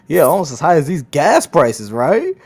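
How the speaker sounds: loud, high-pitched trolling jab, pitch jumping up on "right". Retold or imitated. Trolling a stranger in line about gas prices, trolling voice. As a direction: toss it out loud and teasing, fishing for agreement. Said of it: "I said it in a very trolling voice".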